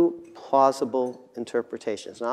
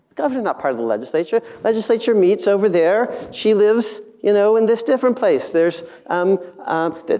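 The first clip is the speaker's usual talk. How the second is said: high-pitched, baffled man-on-the-street reply; incredulous passerby; 7 semitones higher, 5 dB louder than usual. Acted. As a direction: explain the obvious in an exasperated, raised voice. Imagined passerby's incredulous answer.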